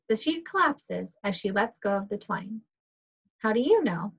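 ordinary talk, nothing marked

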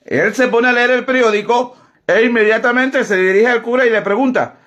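ordinary delivery

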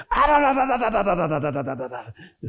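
high blah-blah nagging, fading into a laugh; mocking nag. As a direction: parody the nag until you laugh. Mother's nagging imitated.

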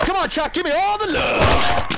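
high, stretched-out victory cry; jubilant calling out. Real, not acted. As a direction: call out slowly and high, elated. Celebrating, calling to teammate.

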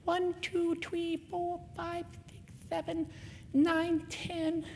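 put-on high, soft small-child voice; imitated toddler, high and quiet; little son counting. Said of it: "my son with his glasses on counting to ten. He goes".

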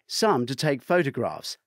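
usual speaking voice

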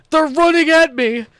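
panicked shriek breaking into laughs; scream into giggles. Real, not acted. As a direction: scream then giggle as they run at you. Chased by guards, screams and laughs.

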